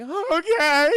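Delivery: high, loud tearful squeak; mock crying. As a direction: agree through hammed-up tears. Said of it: "She's all crying, sniffling".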